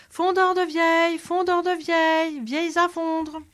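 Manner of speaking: high, loud, strained hawker's yell; full shout. Acted. Proud soldier bellows his new trade down an alley, loud and high. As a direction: bellow it down the alley, chest puffed out. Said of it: "se mit à crier".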